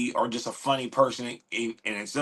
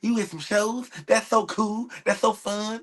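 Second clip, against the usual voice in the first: mock naive outsider; 7 semitones higher than usual. Mocking what outsiders say.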